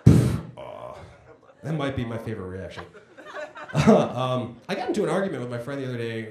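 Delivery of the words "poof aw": a low blown poof, then a deflated aw; mimicking crowd poof. Comic echoing the crowd's poof and aw. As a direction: echo the audience's poof and aw back at them. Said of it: "That got a poof and an aw".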